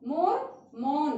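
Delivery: मोर with close o, मौन with open au, slowly; slow contrast. Shown as a demonstration. Teacher summing up o versus au, slow. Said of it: "तो ये अंतर है दोनों की मात्राओं का".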